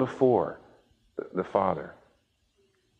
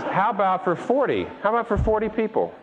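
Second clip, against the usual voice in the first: pushy bargaining; 8 semitones higher than usual. Acted. Abraham bargaining, pitch rising.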